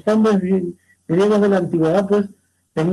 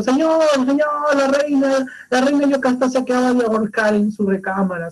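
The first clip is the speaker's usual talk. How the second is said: urgent alarm; 5 semitones higher than usual. Acted. Running messenger tells the king the queen hanged herself, high and urgent. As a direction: rush in with terrible news. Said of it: "viene un mensajero corriendo".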